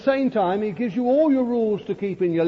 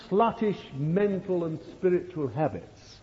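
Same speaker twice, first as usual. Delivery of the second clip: normal talking voice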